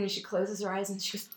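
normal talking voice

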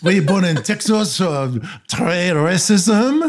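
high Franglais, near laughter; mock French accent. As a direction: drag out the hammy French accent, amused. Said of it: "With a terrible accent".

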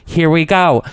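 theatrical, starting high then settling; hammed-up indignant open letter; dramatic reading. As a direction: play up the drama of each phrase. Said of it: "I'm gonna do a dramatic reading".

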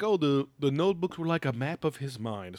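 mock Swedish accent. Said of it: "he's like".

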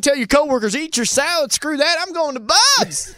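hammed-up sponsor plug; radio ad voice. Mock radio ad read.

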